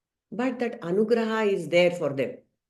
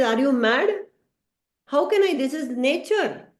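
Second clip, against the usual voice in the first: indignant refusal; 6 semitones higher than usual. Acted. Varuna protests to Naradji, indignant and high. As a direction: brush off the request as absurd.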